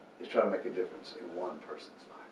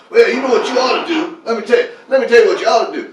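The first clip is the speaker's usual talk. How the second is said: much higher and louder, fast lecturing; preachy know-it-all; 14 semitones higher, 20 dB louder than usual. Pretend. Outreach worker talking down to a homeless man, loud and preachy. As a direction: lecture down at them like you know better.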